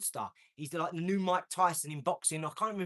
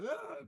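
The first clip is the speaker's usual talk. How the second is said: mock child cry; 12 semitones higher, 5 dB quieter than usual. Imitating a crying daughter.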